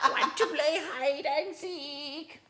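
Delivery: put-on very high, slow creepy old-lady voice; sing-song like a sinister granny. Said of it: "Can you do the Granny voice?".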